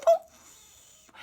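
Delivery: high loud playful woof; dog bark imitation. Shown as a demonstration. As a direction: bark like a small dog for kids. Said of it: "and dog, woof".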